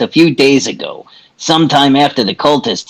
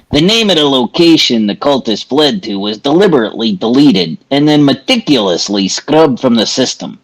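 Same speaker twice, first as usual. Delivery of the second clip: usual speaking voice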